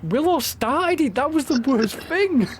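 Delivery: high, slow whiny protest; high indignant protest. Real, not acted.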